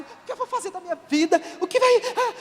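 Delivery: high-pitched panicked babble; mock desperation. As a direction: act out someone losing it, pitch climbing fast. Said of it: "você tá lá desesperado". (desespero encenado, voz aguda)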